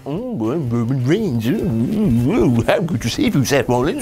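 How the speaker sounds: lightly mock him as a moping big dog; mocking Roman Reigns. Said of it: "he sorted out all like".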